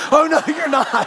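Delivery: high, loud laughing protest; laughing panic. Retold or imitated. Pastor recalling the co-pilot heading to the bathroom, laughing protest. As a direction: laugh out a horrified no. Co-pilot leaves him flying alone.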